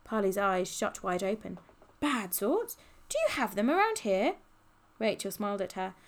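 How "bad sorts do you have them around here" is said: high, rising astonished query; alarmed question. A narrator's reading. Polly asking Rachel about thieves, alarmed. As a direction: ask wide-eyed, pitch leaping up.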